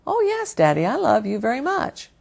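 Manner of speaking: put-on high, soft child voice; sweet little girl. Jenny telling her daddy she loves him, high soft child voice. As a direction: answer sweetly like a small girl.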